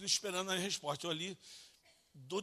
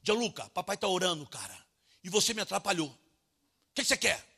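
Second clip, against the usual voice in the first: raised, higher, exasperated scolding; upset father; 4 semitones higher, 8 dB louder than usual. Retold or imitated. Father to son who interrupted his prayer, upset and loud. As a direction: retell a dad snapping at an interruption. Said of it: "meio transtornado".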